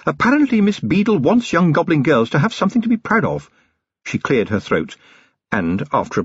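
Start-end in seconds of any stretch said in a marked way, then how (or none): 0.1-3.5 higher-pitched wife making conversation; chatty. A narrator's reading. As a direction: keep it deliberately casual and gossipy. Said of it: "in a curiously chatty voice".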